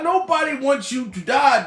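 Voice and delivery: usual speaking voice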